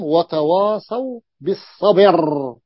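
slow recitation of وتواصوا بالصبر, the ba of بالصبر voweled with kasra and the ra stopped silent; Quranic recitation with naql. Shown as a demonstration. Teacher to students, demonstrating the pause on بالصبر slowly with kasra moved to ba. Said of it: "وقف على الراء بالسكون ونقل حركة الراء إلى الباء".

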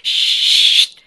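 loud, sharp "sht" hiss; hissed shush. A narrator's reading. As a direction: hiss for silence. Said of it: "sibilò la signora Brill".

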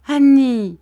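loud alarmed call of her name; doctor cries out to his wife, startled. A narrator's reading. Doctor calling to his kneeling wife, loud cry. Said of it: "cria le docteur".

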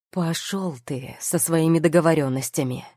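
ordinary delivery, nothing marked

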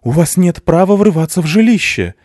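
shouting man. A narrator's reading. Angry father shouts at the intruders, loud and high. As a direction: yell at the intruders in his house.

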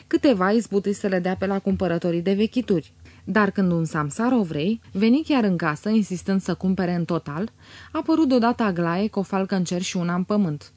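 ordinary delivery

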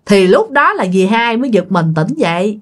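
usual speaking voice

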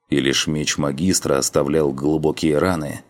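ordinary delivery, nothing marked

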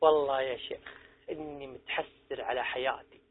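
put-on low, soft, regretful old man's voice. Preacher voicing a regretful old man in his mosque, low and soft. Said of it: "يجيني واحد منهم يقول".

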